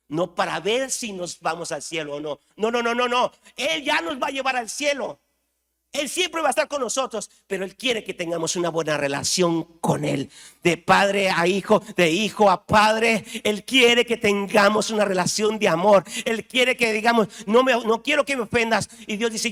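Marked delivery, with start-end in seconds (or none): 2.6-7.3 rising, louder insistence, much higher; emphatic preaching. Real, not acted. Preacher assures the congregation, climbing higher and louder. 10.6-18.9 preaching at full force. Acted. Pastor preaching to the congregation, loud and high.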